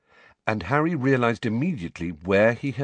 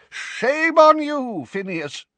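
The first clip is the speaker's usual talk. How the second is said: scolding; 6 dB louder, slower than usual. A narrator's reading. Portraits protest.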